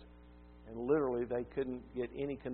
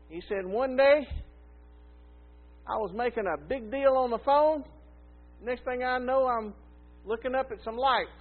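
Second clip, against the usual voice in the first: put-on high, raised old man telling his tale; old man's voice; 8 semitones higher, 5 dB louder than usual. Old man telling the preacher his story, high and raised. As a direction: tell it like a proud old CEO.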